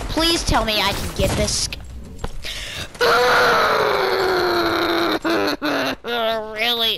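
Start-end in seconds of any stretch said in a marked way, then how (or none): 1.7-6.6 wild laugh. Real, not acted. Gamer laughing at a chaotic moment, shrieking laugh. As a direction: burst out in a screaming laugh. Big laugh while gaming.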